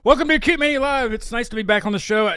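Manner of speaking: high, loud show welcome; big, high-pitched host's greeting; show-opening announcement. Real, not acted. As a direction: launch the show high and loud, easing slightly. Host opens the live show.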